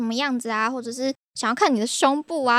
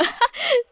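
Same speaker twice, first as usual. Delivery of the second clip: high giggle. Real, not acted. Laughing about red envelope.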